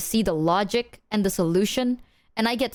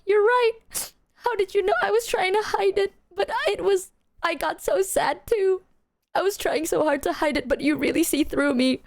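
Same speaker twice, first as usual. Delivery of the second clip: mock tearful; 8 semitones higher than usual. To her crying mom, hammed-up fake sadness. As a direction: play the fake tears for mom's sake. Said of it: "So I'm like".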